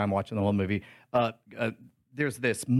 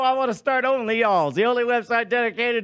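higher, louder salesy delivery; mock pitchman; 7 semitones higher, 4 dB louder than usual. Pitching a joke website to listeners, mock salesy. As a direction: sell the joke site like an ad.

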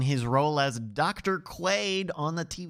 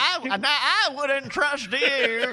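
slow, higher, drawn-out impression; hammed-up impression; 6 semitones higher, 3 dB louder, slower than usual. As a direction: stretch it into a comic doctor impression. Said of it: "is that your impression?".

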